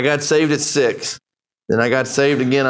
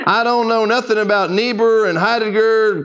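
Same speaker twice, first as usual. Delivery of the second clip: put-on old preacher voice; 7 semitones higher than usual. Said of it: "an old dark-skinned preacher".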